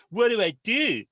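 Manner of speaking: hammed-up frightened teen; panicked daughter. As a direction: plead in a panic.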